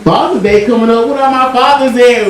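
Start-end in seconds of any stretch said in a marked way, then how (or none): none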